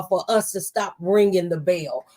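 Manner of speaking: ordinary talk, nothing marked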